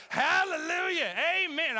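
high, loud, slow hallelujah shout; preacher shout. Retold or imitated. Pastor getting into it in the kitchen, loud preacher shout. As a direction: holler it like a Sunday preacher. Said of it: "I started doing that".

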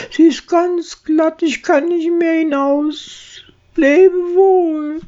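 high, desperate little mouse; tiny trapped mouse saying farewell; squeaky mouse voice. Acted. Bräutigam in der Grube. (verzweifeltes hohes Mäusestimmchen)

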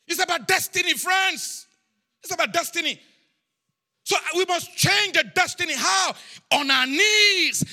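rally them with rising pitch; urgent full-force preaching. Real, not acted. Preacher urging the congregation to change destiny, urgent preaching.

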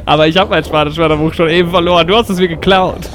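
ordinary talk, nothing marked

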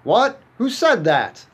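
high, loud startled cry. Acted. Ray, alone in his cornfield, hears a voice and cries out startled. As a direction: jump out of your skin.

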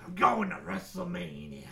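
growly put-on wrestler declaration; hammed-up wrestler voice at speaking pitch; wrestling promo. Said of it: "doing a promo".

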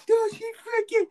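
high shriek over the prank; excited yelling. Retold or imitated. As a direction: squeal it out from your chair. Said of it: "probably yelling".

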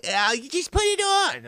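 very high, slow pushy mom voice; hammed-up mother coaxing her son; Diane. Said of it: "I could see your mom actually".

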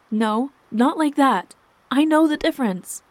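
high, loud, insistent denial; heated argument. A narrator's reading. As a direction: fire back, upset and emphatic. Wife shouting back in marital quarrel.

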